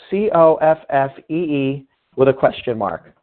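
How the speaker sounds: ordinary delivery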